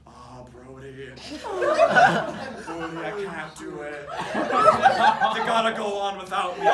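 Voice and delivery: slow, hammed-up tragic hero goodbye; melodramatic farewell. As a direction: drag it out like a dying movie hero. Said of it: "she actually spoke like this".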